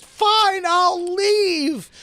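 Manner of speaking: loud, very high mock wail; hammed-up high, tearful cry; tearful outburst. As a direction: imitate a woman storming off in tears. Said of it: "she turned back in tears".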